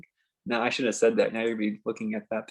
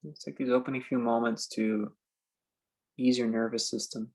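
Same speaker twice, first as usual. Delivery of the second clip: slow, spacious meditation guide with long pauses; calm phrases left hanging in silence; guided meditation; 3 dB quieter, slower than usual. Acted. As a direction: ease them into stillness, unhurried.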